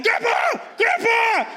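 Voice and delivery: desperate high-pitched call; panicked yell. Retold or imitated. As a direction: yell for rescue like a frantic kid. Child yelling for help again.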